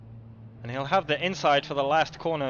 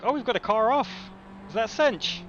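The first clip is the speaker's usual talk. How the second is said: excited; 7 semitones higher than usual. Real, not acted. Car spins off track live.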